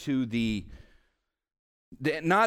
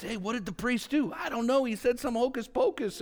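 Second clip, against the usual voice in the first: higher-pitched voices of baffled congregants; quick confused exchange, pitched up; puzzled churchgoers; 9 semitones higher than usual. Acted. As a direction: act out people asking each other in the pews. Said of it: "you could imagine people sitting in the audience".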